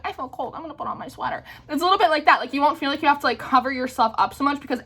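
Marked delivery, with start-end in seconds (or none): 0.0-1.4 mock quiet complaint. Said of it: "I was like".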